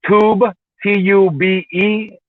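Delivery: slow careful dictation of letters; slow spelling out. Shown as a demonstration. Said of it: "the dash has to be there".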